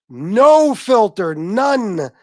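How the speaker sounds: "No filter, none" drawn out, loud and high; emphatic. Real, not acted. Telling viewers Pee Wee has no filter, emphatic. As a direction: stretch "No" and "none" hard, voice swooping up. Hammering home his own point.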